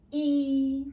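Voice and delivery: E held loud, high and level; high flat tone. Shown as a demonstration. Teacher counts together with pupils, high flat tone. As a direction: keep E up on one note. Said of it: "flat tone is a high-pitched tone".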